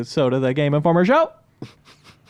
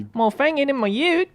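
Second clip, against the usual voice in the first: Australian accent; 9 semitones higher than usual. Shown as a demonstration. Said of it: "To go really fast in a car".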